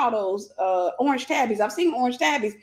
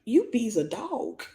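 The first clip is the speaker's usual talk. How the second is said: deadpan realization; 4 dB quieter, slower than usual. Retold or imitated. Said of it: "And I'm like".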